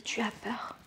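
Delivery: hushed, fearful whisper; scared whisper. Shown as a demonstration. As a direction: whisper it as if afraid. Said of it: "Et la peur aussi". (murmuré avec peur)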